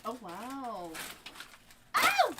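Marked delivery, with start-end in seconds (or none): 2.0-2.3 sharp, high, loud yelp; a real startled ow at full pitch; short pained yelp as she hurts herself; sudden yelp of pain. Real, not acted. Sudden pain while reaching.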